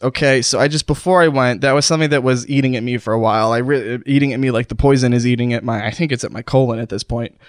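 ordinary talk, nothing marked

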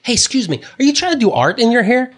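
very high, imitated mom voice; mom accosting strangers. Mom asks teenage strangers about their hair, high and eager.

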